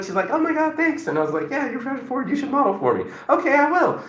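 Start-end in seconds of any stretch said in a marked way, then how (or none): 0.3-1.1 imitating a girl's high, gushing thanks; flattered girl. Girl thanking him for a compliment, high gushing. 1.5-3.0 high, friendly sales pitch; retold compliment. Man inviting a girl to model, high and friendly. 3.3-4.0 imitating a girl's high, eager yes; agreeable girl. Girl agreeing to model, high and eager.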